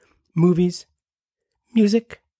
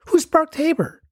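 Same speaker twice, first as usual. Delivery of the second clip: higher-pitched voice of a curious listener; mock listener; 10 semitones higher than usual. Ad read as imagined listener asks, higher voice. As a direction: ask like a puzzled stranger.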